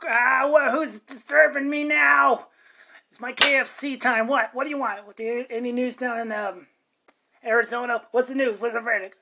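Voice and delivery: [name] as Scrooge. Acted. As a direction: bark like a disturbed Scrooge. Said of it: "[name] in, um, Scrooge".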